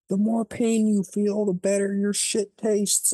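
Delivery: high, mocking vampire sneer; villain taunt. Vampires gloating over the kid they are pinning down, sneering. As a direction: taunt the victim like a gloating monster.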